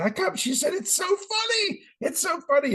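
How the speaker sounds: high mock daughter gushing; daughter laughing. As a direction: squeal it like a delighted young woman. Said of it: "She's like".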